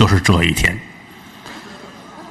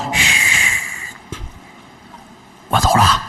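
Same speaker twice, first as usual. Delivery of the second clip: whisper; 4 semitones lower, 3 dB quieter, much slower than usual. Acted. Lover sneaking off, whispers.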